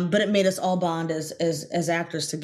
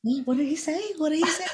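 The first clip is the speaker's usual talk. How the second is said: puzzled; 10 semitones higher than usual. Retold or imitated. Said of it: "I'm like".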